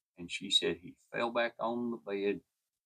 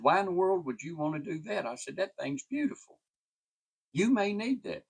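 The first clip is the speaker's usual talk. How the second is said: retold disbelief; 9 semitones higher than usual. Narrator retells pleading with woman.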